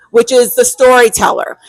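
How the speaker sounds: ordinary talk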